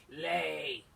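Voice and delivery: loud roared command; booming giant giving an order; giant's roar. Acted. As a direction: bellow it like a storybook giant. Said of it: "on the table and roared".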